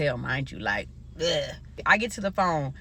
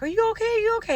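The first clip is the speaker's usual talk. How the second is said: mock yelling; faster than usual. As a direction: imitate worried relatives yelling down the phone. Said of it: "they're yelling".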